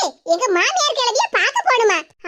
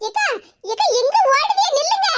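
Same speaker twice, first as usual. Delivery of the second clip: high, rising call to stop her; calling after; 6 semitones higher than usual. Acted. Younger sister calls after the fleeing elder sister, pleading. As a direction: shout after someone running off.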